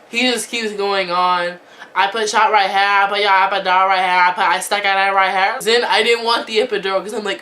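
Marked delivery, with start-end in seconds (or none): 1.9-5.6 put-on Korean accent. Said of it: "So this Korean guy comes in".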